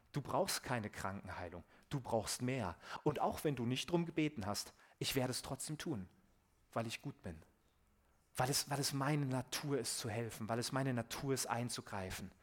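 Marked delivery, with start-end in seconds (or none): none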